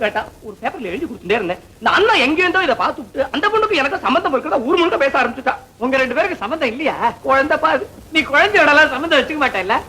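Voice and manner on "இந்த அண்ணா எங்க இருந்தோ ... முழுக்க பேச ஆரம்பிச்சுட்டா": ordinary delivery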